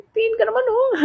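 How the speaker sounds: high, whiny little plea; mock pleading. Asking friend to look over, mock pleading. Pleading quote, then laughs.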